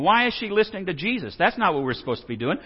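ordinary talk, nothing marked

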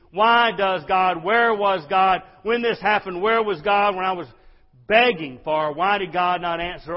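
building accusatory cry. Acted. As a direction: let the grievance swell into a shout at God. People blaming God, voiced.

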